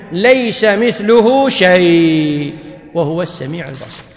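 slow, drawn-out recital of the altered verse; slow mock recitation. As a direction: recite it slowly, as if reading their version. Mock reciting the opponents' version. (تلاوة بطيئة ساخرة)

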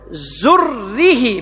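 زره said very slowly, ending in an i; zurri with kasra. Shown as a demonstration. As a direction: drag out زره with a final kasra. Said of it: "بكسر الراء".

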